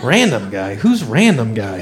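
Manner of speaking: low, puzzled muttering to himself; mildly baffled, wondering who it is. Retold or imitated. Puzzled at caller's name on phone.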